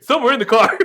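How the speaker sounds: high, loud words through laughter; laughing. Real, not acted. Friend laughing about keys lost in the car, laughing loudly. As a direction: laugh while saying it.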